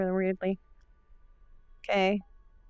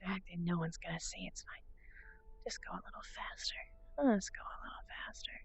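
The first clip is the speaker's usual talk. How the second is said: quiet whisper; 12 dB quieter than usual. Real, not acted. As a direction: murmur under the breath. Whispering while walking avatar.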